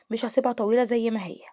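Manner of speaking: ordinary talk, nothing marked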